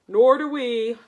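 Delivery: loud group reply; chorus. Acted. Knights agreeing with the dragon, loud chorus. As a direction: answer together as the knights. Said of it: "chorused the knights".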